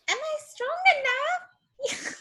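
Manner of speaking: put-on very high pixie voice, borrowed accent. River answers the prisoner, very high accented pixie voice. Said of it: "Why do I have your accent".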